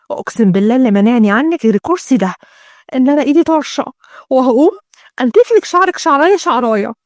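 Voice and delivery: ordinary delivery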